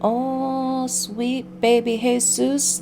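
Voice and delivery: ordinary delivery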